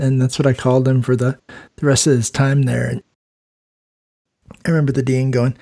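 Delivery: usual speaking voice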